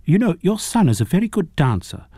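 high, bursting enthusiastic praise; a sudden eager outburst; blurted out. A narrator's reading. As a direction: blurt it out excitedly. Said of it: "she suddenly blurted".

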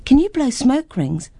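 put-on thrilled girl asking; excited little girl. Girl asks dragon.

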